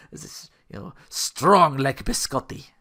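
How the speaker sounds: put-on playful Russian accent, taking its time. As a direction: drag it out in a mock Russian accent. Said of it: "I did a Russian accent".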